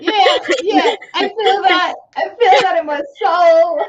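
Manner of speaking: laughing high through the words. Real, not acted. Friend laughing hard at a relatable fear. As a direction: crack up while agreeing.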